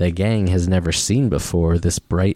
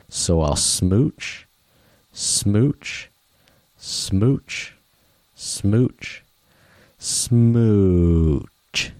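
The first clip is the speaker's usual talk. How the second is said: kissy smooches; much slower than usual. Acted. Fish's happy kiss refrain.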